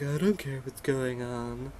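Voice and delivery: slow, put-on apathetic cat voice; lazy mock-cat voice; indifferent cat. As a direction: drag it out like a bored cat.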